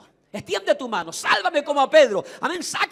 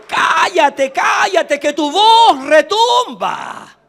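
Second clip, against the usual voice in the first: mock shushing yell; 8 dB louder, much slower than usual. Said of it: "Le dijeron".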